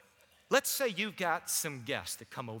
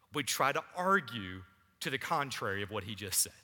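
earnest preaching at full voice; sermon preaching; 3 semitones higher, 5 dB louder than usual. Acted. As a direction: drive the point home to the congregation. Preaching from the pulpit.

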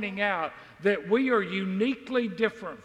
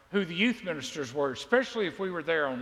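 normal talking voice

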